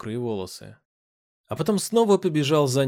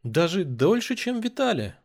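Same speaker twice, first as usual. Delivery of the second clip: drawling tease; 7 semitones higher than usual. A narrator's reading. Man answering the princess at gunpoint, drawling. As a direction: let the pitch climb as you draw it out. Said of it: "протянул".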